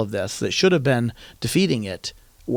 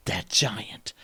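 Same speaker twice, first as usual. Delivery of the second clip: whispered; 6 semitones lower, 7 dB quieter than usual. Real, not acted. Whispered emphasis.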